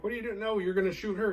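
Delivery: high panicked friend; panicked high mock yelp. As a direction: squeal it in alarm. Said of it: "Mike's like".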